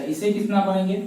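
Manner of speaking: ordinary talk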